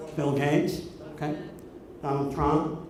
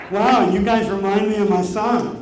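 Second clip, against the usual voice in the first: ordinary talk, nothing marked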